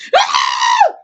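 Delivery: very high, shrieking falsetto scream; mock scream. Reviewer imitating the bathtub scene, mock high scream. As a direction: let out a comic high-pitched shriek. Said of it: "screaming like a bitch".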